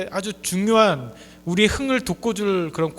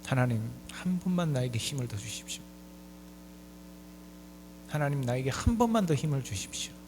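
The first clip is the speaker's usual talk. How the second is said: soft, lowered acted plea; earnest prayer; 4 semitones lower, 8 dB quieter than usual. Blinded Samson begging God for strength once more, quietly earnest. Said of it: "하나님 앞에 간절히 기도합니다".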